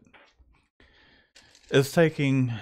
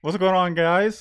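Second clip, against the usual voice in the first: upbeat greeting; 8 semitones higher, 5 dB louder than usual. Real, not acted. Greeting the stream audience.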